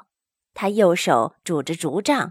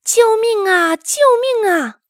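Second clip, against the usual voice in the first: loud cry for help; 13 semitones higher, 6 dB louder than usual. A narrator's reading. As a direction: call out in alarm to people behind you. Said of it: "大声呼喊". (高声呼救)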